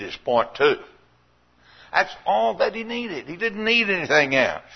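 rising preaching. Acted. Preaching builds again.

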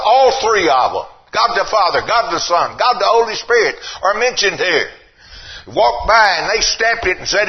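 high, loud preacher's cadence; preaching. Acted. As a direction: drive each line home to the church. Preaching at full force.

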